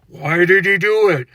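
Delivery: a lightly voiced grave documentary narrator; mock the solemn narrator asking why. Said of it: "the narrator is behind him saying".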